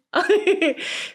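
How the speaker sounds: high bright laugh at her own story; burst of laughter. Real, not acted. Laughing at Brie while telling the story. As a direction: let a real laugh burst out.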